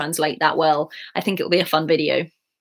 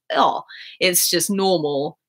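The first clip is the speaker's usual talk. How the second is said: ordinary delivery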